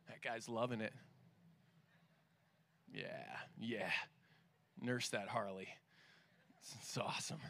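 very low, very soft aside; nearly under the breath, off to the side; amused aside. Real, not acted. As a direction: pause the talk to quietly admire something passing. Aside about passing Harley.

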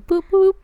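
high squeaky boop boop; playful boop sound effect. Real, not acted. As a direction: chirp it like a button beep.

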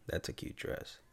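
hushed, creepy near-whisper; creepy compliment. Shown as a demonstration. Showing how the same compliment turns creepy, whispered. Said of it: "how the tone just changes everything".

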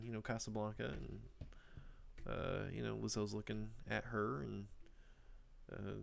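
ordinary talk

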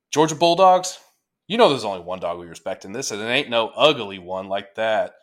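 hype read. Acted. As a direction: read it like a rowdy pep rally. Reads Toomer's hype piece aloud.

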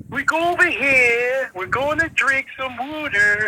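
slow, high mock Philly accent. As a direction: parody a Philly girl from the SNL skit. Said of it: "It was like".

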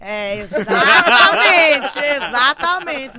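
slow, loud, laughing little voice. Shown as a demonstration. Joking about ginger candy, in her little voice. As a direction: stretch it out in the little voice. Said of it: "pra conseguir fazer essa vozinha".

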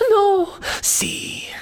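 high gasped Spanish melodrama; mock telenovela shock, Spanish accent; Spanish soap opera. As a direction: gasp a theatrical "no" in a Spanish accent. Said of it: "Spanish soap opera".

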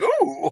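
very high swooping "ooh"; high-pitched whoop of mock alarm; little falsetto yelp of wonder; imitated startled ooh. Imitates own reaction to sparks.

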